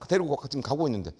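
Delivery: ordinary delivery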